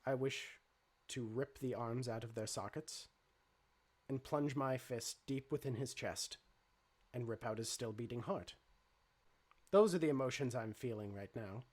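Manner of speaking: low, even, measured robot; calm robot voice. Acted. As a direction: speak like a calm android.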